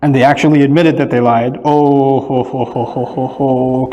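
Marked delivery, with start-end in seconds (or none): 2.2-3.9 mock doom. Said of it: "كأنه يوم القيامة".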